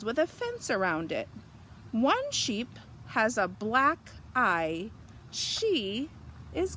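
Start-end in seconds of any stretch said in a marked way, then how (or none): none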